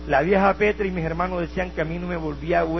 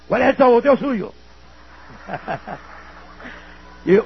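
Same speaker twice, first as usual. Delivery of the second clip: ordinary talk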